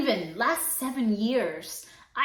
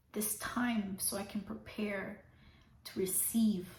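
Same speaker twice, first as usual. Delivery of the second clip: whisper; 4 semitones lower, 13 dB quieter than usual. Real, not acted. Quiet emotional close.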